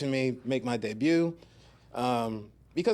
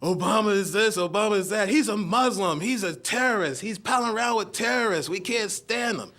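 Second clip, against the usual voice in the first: mock conservative rant; 6 semitones higher than usual. Comic quoting conservative critics in a mock outraged voice. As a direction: ham up an angry critic of Obama. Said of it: "they were like".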